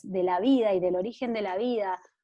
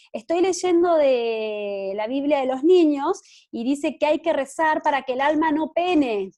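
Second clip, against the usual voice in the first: put-on higher, louder child explaining herself; imitated little girl; 5 semitones higher, 7 dB louder than usual. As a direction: call it out like a kid answering her parents. Retold child answering her parents loudly.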